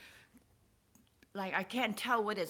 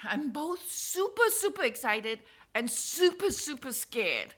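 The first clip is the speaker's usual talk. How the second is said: blurting out real nerves and thrill; super excited; 7 semitones higher, 5 dB louder than usual. Real, not acted. Vlogger telling viewers her feelings, genuinely excited. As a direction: voice climbing, louder, nearly squealing. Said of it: "I'm so emotional right now".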